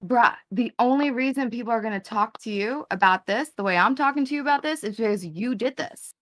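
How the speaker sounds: normal talking voice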